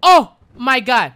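loud, high, drawn-out alarm; real fright. Real, not acted. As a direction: cry out slowly in shock. Sudden scare in game.

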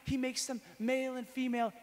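high, loud, driving sermon voice; fervent preaching. Real, not acted. Teaching a crowd about the Trinity, fervent preaching. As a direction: preach it with full fire.

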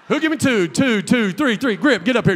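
loud laughing auction call; joking loud call. Acted. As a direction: shout it out playfully.

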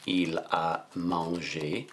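drawn-out French at typing speed; slow dictation. Shown as a demonstration. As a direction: say each word slowly while typing it. Retyping the sentence aloud.

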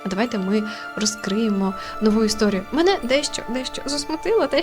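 usual speaking voice

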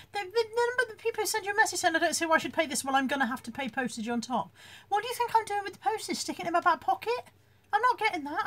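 incredulous, put-upon seller; indignant mock rant. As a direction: rant at cheeky customers, voice climbing. Mock rant at demanding buyers.

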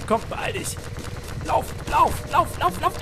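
high rushed urging; urgent panic. Real, not acted. Gamer fleeing, high urgent chant. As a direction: push yourself to run. Running for safety.

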